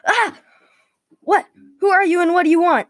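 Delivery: high, loud, fast outburst; startled yell. A narrator's reading. Daniel wakes to a voice and draws his sword, startled yell. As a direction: cry out, then fire off panicked questions. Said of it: "Daniel yelled".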